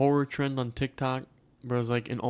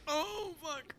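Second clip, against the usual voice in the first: high, soft scared squeak; a frightened little oh fuck; mock scared; 11 dB quieter than usual. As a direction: squeak it out like you just saw it. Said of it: "and it's just".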